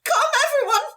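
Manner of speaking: very high, shrill-posh calling out with rolled R; posh lady shouting. Acted. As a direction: raise the alarm like a grand lady. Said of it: "The rolling of that R, iconic".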